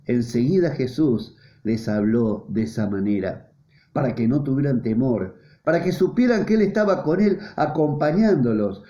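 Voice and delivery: ordinary talk, nothing marked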